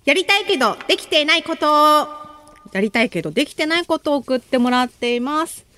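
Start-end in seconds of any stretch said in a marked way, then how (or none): 0.0-1.7 radio title call, higher than usual chat; theme title call. Real, not acted. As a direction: announce the segment title with a lift. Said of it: "今日のテーマはこちら".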